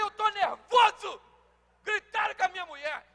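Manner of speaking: husband shouting in defence of wife. Retold or imitated. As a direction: shout it for the whole house to hear. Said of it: "falando alto".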